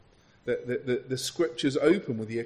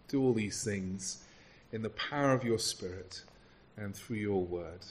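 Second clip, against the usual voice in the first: low, measured prayer voice; public prayer; 3 semitones lower than usual. Real, not acted. Preacher praying before opening God's word, low reverent prayer. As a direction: pray steadily, softening toward the amen.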